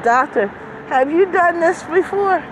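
high-pitched worried question; nervous patient voice. Retold or imitated. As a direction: anxiously ask the doctor. Retold anxious question to doctor.

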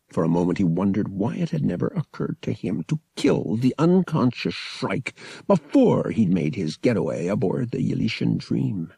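usual speaking voice

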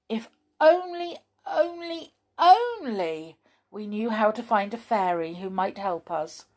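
high, yearning repeated wish; longing. Acted. Molly wishing desperately for a fairy's help, yearning. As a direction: plead the words wistfully.

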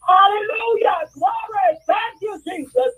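high tearful cries of thanks to the Lord; praising God. Retold or imitated. Retelling how she praised God when her niece cried, high tearful shouts. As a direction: cry out hallelujah and thanks, voice breaking with joy. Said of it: "I started praising God".

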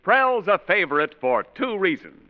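ordinary delivery

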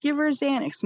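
high, urgent repeated demand; retold frantic plea for medicine. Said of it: "I'm like".

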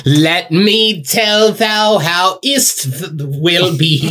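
slow, high mock Old English. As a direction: ham up fake archaic English. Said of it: "talks in Old English".

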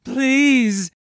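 high, loud desperate cry; desperate plea. Acted. Tortured captive begging the captain, loud desperate plea. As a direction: beg for mercy at the top of the voice. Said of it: "he moaned".

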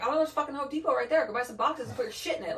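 mock-impatient scolding, laughing through it; exasperated. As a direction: rattle it off like the answer is obvious. Retold exasperated reply about boxes.

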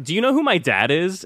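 high, haughty brat voice; entitled brat. Retold or imitated. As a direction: play a spoiled kid. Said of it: "your words are like".

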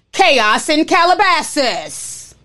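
slow, drawn-out tabloid announcer voice; milk it like an over-the-top TMZ anchor; mock dramatic headline. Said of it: "T M Z is so fucking dramatic".

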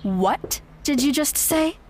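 slow, high affronted question; indignant challenge. Acted. Fiancée hearing an insult, slow affronted challenge. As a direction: dare her to repeat it.

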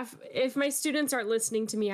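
usual speaking voice

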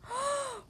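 high, sharp gasp of wonder; amazed gasp. Acted. Cubs seeing the inside slide, amazed gasp.

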